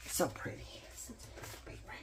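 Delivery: hushed, breathy awe at the paper; admiring whisper. Real, not acted. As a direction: whisper to yourself in delight. Admiring pretty paper softly.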